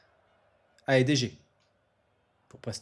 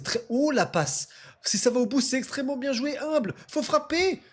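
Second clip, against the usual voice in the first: excited sports commentary; 9 semitones higher than usual. Real, not acted. Attack building toward a shot.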